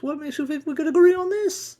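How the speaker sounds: high, loud scolding outburst; shrill angry Kendall. Acted. Kendall scolding him over the breakfast supplies, shrill and angry. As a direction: yell at him for using up supplies.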